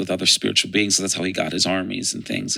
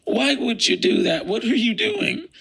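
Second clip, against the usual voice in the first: much higher, pleading cry of disbelief; emotional anguish; 13 semitones higher than usual. Retold or imitated. Gabriel confronting the fallen Lucifer, emotional and anguished. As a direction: plead in distraught, high-pitched shock. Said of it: "who was more emotional".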